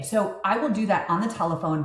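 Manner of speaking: ordinary talk, nothing marked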